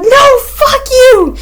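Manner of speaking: very high, slow mock yell; mock outrage. Yelling after the pigeon that left, high mock outrage. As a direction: hurl it at the departing pigeon. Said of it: "And I was like".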